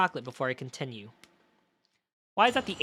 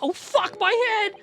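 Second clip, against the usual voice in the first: shocked outburst; 5 dB louder, slower than usual. Real, not acted. Reacts to the bottle hit.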